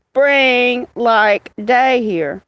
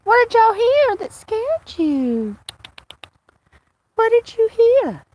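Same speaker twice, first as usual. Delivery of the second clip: high sing-song pet voice; talking to pets; 11 semitones higher than usual. Real, not acted. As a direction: coo the question to the cats. Talking to her cats.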